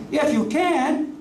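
slow, high, incredulous if you can; ticked-off Jesus repeating the words; indignant echo. Retold or imitated. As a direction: throw the words back in disbelief. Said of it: "Jesus is a little ticked off".

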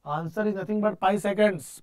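normal talking voice

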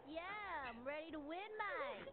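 soft mock Australian accent; quiet, casual Aussie imitation; put-on Australian accent. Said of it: "What makes you Australian? This voice".

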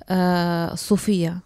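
normal talking voice

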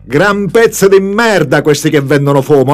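loud indignant rant; explosive italian cursing. A narrator's reading. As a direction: rant against the dealers. Mock-indignant outburst at dealers. (sfogo indignato)

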